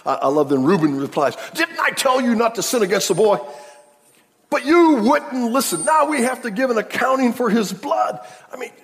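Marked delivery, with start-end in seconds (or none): 1.5-8.2 loud, high scolding cry; 1 reproachful shout. Retold or imitated. Reuben scolding his brothers, loud reproachful cry. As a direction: rebuke the brothers hard.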